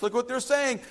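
usual speaking voice

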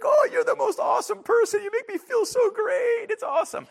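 put-on squealing high, loud, delighted little baby. Preacher voicing a baby adoring the adult, high squealy baby voice. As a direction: gush like a thrilled infant.

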